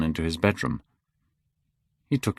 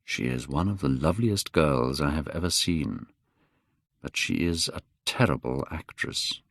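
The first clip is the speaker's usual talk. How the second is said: softer, confiding aside; 6 semitones lower, 6 dB quieter than usual. A narrator's reading. Lord Henry privately telling Hallward, a soft aside. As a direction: murmur it aside to a friend. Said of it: "Lord Henry whispered to Hallward".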